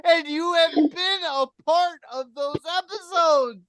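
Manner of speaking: scolding a cohost who never listens, in hammed-up outrage; mock outraged rant.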